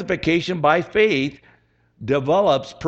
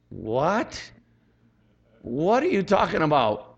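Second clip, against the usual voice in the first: slow-to-sink-in, questioning double take; baffled disbelief; slower than usual. Acted. Preacher acting a reader let down by scripture, baffled. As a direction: question it as if you misheard.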